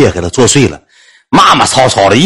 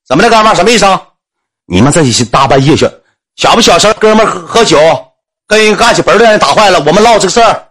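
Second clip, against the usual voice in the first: put-on drunken argument; 8 semitones higher than usual. Drunk neighbour arguing loudly.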